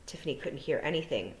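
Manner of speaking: usual speaking voice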